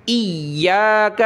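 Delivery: ایاک with the یا held hard and doubled, slow. Shown as a demonstration. Teacher showing pupils the shadda, ایاک with heavy doubled یا. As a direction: press and hold on the یا in ایاک, slowly. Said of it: "یعنی یا پر سختی کر رہا ہوں".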